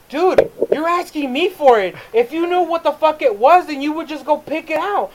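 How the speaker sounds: high-pitched indignant reasoning; exasperated argument. Retold or imitated. Game clerk to grandfather insisting on Pikachu DS, exasperated and high. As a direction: argue back, fed up with the customer. Acts out arguing with the customer.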